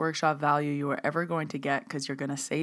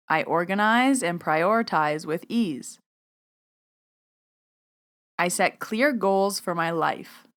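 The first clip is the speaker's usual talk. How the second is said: affirmation reading. Acted. Host reading affirmations for listeners to repeat aloud. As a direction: state each line firmly, leaving room to repeat.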